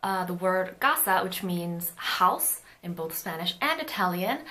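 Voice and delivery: ordinary talk, nothing marked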